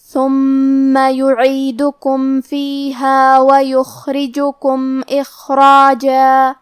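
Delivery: slow, melodic tajweed chanting; Quran recitation. Shown as a demonstration. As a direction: draw out each vowel in recitation.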